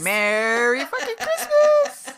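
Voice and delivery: slow, loud, laughing toast; laughing shout. Real, not acted. Toasting her cohost with a loud laughing cheer. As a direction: belt it out through a laugh.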